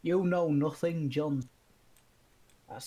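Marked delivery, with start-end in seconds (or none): 0.0-1.4 put-on Northern accent. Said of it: "in comparison to".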